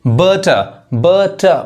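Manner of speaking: बटर with no final r, "buh-tuh"; British-style बटर, r dropped; silent r. Shown as a demonstration. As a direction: end बटर on a plain schwa. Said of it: "मेरे pronunciation पे ध्यान दीजिए".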